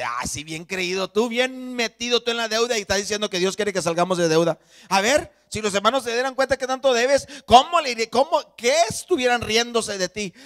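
loud, high, taunting devil's voice; mocking tirade, building in force; accusing devil. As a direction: jeer at the believer like an accuser. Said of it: "el diablo te comienza a hablar".

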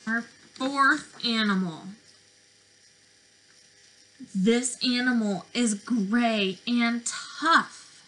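put-on lively, sing-song picture-book voice for little kids; reading to children. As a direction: read it like circle time, drawing them in.

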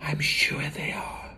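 soft, voiceless whisper. A narrator's reading. Matilda confides to her family, whispering. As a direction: whisper it with conviction. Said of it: "Matilda whispered".

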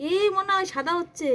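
high, loud burst of excitement; excited discovery. Real, not acted. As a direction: call it out as you spot it happening.

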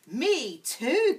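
eager giraffe gasping agreement; gasped. Acted. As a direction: gasp it out in delight. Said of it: "gasped Cherry".

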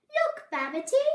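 high, loud mouse voice; cheery, playful call. A narrator's reading. Mouse shows Babbitty a trick, cheery call. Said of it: "laughed Mouse, trying to cheer him up".

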